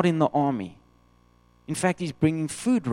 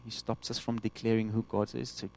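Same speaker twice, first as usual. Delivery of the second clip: usual speaking voice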